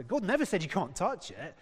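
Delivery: ordinary talk, nothing marked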